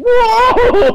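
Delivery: very high, loud mock "whoa"; hammed-up holler, drawn out; imitated yell. Friend grabbing a guy to drag him out, imitated yell.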